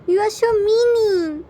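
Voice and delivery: put-on high, loud whiny kid; sulky child. A narrator's reading. Son pouting at his appa, whiny and loud. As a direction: pout and whine it.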